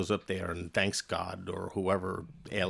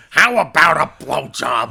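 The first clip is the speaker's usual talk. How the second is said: loud, slow, higher-pitched obnoxious comic voice; Gilbert Gottfried; 4 semitones higher, 11 dB louder, slower than usual. Acted. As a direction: blare a proposition in an annoying put-on voice. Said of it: "he doesn't talk like that".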